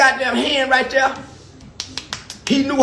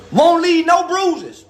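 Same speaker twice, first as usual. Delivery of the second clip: ordinary talk, nothing marked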